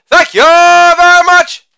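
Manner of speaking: high, slow, drawn-out thank-you like a lounge crooner; hammed-up stage thank-you; showman sign-off. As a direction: stretch out the thanks like a showman taking a bow.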